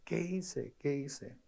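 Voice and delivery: susurro quebrado de madre que llora; madre llorando, imitada. Retold or imitated. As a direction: lamenta bajito, casi sin voz, como la madre. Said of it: "llorando me decía". (imitando a la madre llorosa, muy bajo)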